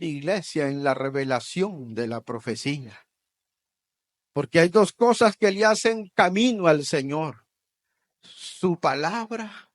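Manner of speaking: ordinary talk, nothing marked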